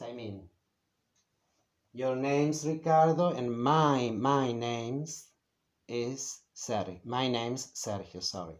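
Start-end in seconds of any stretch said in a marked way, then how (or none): 1.9-5.3 "my" leaned on loudly against "your"; contrastive emphasis. Shown as a demonstration. Teacher contrasts Ricardo's name with their own, contrastive emphasis. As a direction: stress "my" to contrast the two names. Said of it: "I'm putting the emphasis here".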